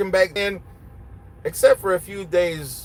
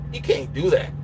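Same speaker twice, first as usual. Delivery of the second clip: ordinary delivery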